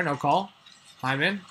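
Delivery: ordinary talk, nothing marked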